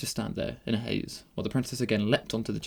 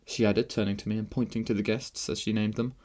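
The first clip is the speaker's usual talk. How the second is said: ordinary delivery, nothing marked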